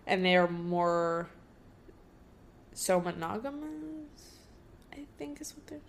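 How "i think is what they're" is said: whispered aside. Real, not acted. Confused aside under breath.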